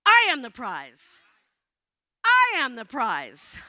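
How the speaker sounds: loud, slow declaration; slow, forceful, leaning hard on "I"; emphatic affirmation. Shown as a demonstration. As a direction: belt it out to rally the room. Leading the crowd, insisting harder.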